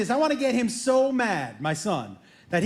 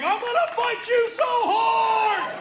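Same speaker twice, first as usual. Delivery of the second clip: son's furious threat; 11 semitones higher, much slower than usual. Retold or imitated. Son yelling at dad over the panties, high mock-furious shout. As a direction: bellow it like an enraged teenager.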